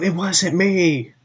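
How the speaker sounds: high-pitched mock child voice; little kid. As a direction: imitate a little kid protesting innocence. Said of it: "you hear a little kid going".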